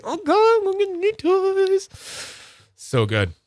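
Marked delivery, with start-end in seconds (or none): 0.0-1.9 very high, giddy squeal. Retold or imitated. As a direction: squeal like a kid with presents. Giddy self on toy Sunday.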